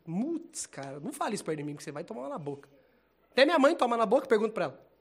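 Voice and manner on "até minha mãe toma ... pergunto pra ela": joking outburst. Acted. Joking threat, voice rising loudly.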